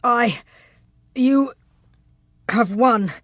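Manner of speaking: halting, winded admission of defeat; broken up by heavy pauses, out of breath; gasping for breath. A narrator's reading. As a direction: force it out word by word between breaths. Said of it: "breath coming in gasps".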